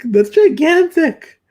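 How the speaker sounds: high, slow, drawn-out amazement; gasping at how big it is; astonished. Real, not acted. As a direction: stretch gigantic way up in disbelief. Marvelling at a huge neck.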